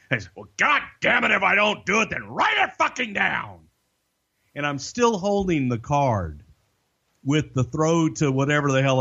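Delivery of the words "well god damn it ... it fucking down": high, fast exasperated boss rant, mimicked; hammed-up furious boss voice pitched way up; imitating angry boss. Boss furious at his producer over a missed cue, imitated high and fast. Vince snapping back at producer.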